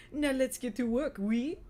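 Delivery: put-on rally them with a hammy French accent. A narrator's reading. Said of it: "in a thick, très joli accent".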